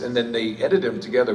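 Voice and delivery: usual speaking voice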